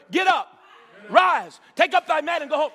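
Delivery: loud, ringing command with force on rise; preacher thundering Jesus' command; shouted command. Acted. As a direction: shout the healing word to the crowd. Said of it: "All he got was one word".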